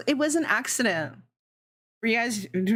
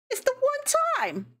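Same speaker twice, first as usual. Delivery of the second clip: much higher, slower, whiny mock voice; It's the one time in a tiny, high whine; squeaky mock whine; slower than usual. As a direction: squeak it out like a cartoon kid. Mock squeaky repeat of own excuse.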